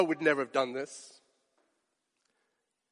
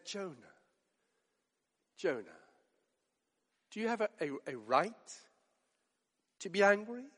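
soft, kind, much quieter questioning; very gently; 3 semitones lower, 12 dB quieter than usual. Acted. God gently asking angry Jonah, soft and tender. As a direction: ask it sweetly, like coaxing a hurt child. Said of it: "asking you a question very kindly, very gently, very sweetly".

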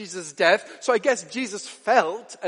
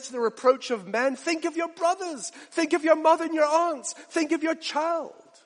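pleading father; 10 semitones higher than usual. Retold or imitated. Father begging daughter Perpetua not to abandon him, high urgent plea. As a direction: press the plea harder, rushing. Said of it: "burst out".

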